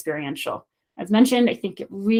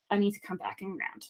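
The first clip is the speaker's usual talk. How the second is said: much softer, settled inner voice; calming self-talk; 13 dB quieter than usual. Acted. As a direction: bring yourself down quietly after the rush. Acting calming self-talk after nerves.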